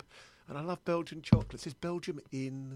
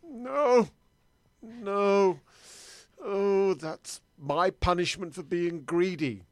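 loud, high, drawn-out cries of dismay; horrified wail; 5 semitones higher, 10 dB louder, much slower than usual. Acted. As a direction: wail in mock horror at your fate. Victim learns of candy-bar punishment.

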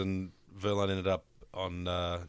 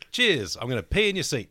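cheeky reply; 6 semitones higher, 6 dB louder than usual. Retold or imitated. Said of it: "Jenson said".

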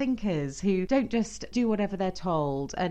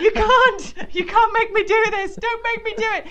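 loud, high, laughing whine; pleading husband; 10 dB louder than usual. Retold or imitated. Husband refusing to take over, loud high laughing whine. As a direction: whine back in mock panic. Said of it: "And he'd be like".

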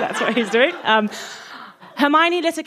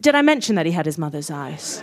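much lower, dry reminder; deadpan aside; 8 semitones lower than usual. A narrator's reading. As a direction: drop low for a knowing aside. Deadpan aside repeating the joke.

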